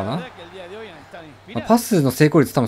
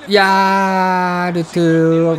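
high, very long shout of a name; drawn-out cry; 5 semitones higher, much slower than usual. Real, not acted. Gamer crying out over Arthur's play, long drawn-out shout.

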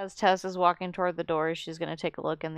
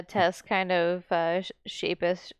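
usual speaking voice

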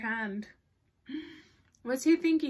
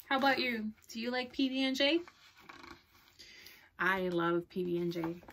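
plain reading voice

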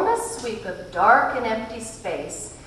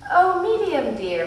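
bright Mrs Whatsit voice; 9 semitones higher, 4 dB louder than usual. A narrator's reading. Whatsit greets the medium.

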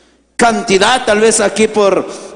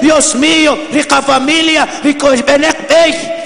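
raised, higher preaching voice; impassioned preaching; 7 semitones higher than usual. Acted. Preacher tells the congregation of migrant sons, impassioned preaching.

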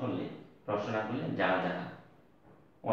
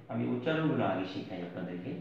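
ordinary delivery

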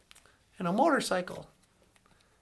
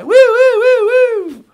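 high, loud whooping siren; imitated police siren; 10 semitones higher, 15 dB louder, much slower than usual. Cop comes by the three riders on a motorcycle, imitated siren. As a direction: wail like a cop car pulling up.

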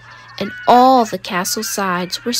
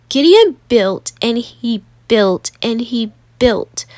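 ordinary delivery, nothing marked

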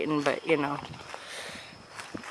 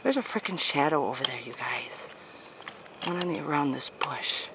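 whispering; 4 semitones lower, 6 dB quieter than usual. Real, not acted. Scared by shadow.

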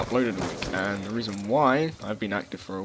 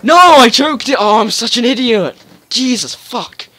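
real angry outburst, much higher and louder; frustrated shout; 13 semitones higher, 16 dB louder, slower than usual. Real, not acted. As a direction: yell at yourself for blowing the shot. Misses clip live, real frustration.

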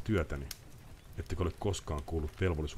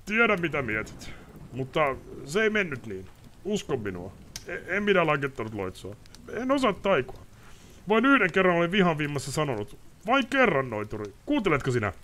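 bitter confession; 11 semitones higher, 5 dB louder than usual. Acted. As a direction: pour out a guilty secret. Ostrid pleading innocence.

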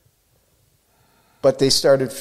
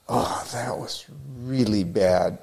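soft and slow, deflated; embarrassed groan; 6 dB quieter than usual. Retold or imitated. Surfer judging his own footage, soft and deflated. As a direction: let it sink, slow and soft. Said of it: "so embarrassed".

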